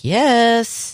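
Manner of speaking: high, drawn-out gleeful yes; acted delight. Child finally getting wheat bread, delighted. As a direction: relive a small childhood thrill, pitched high.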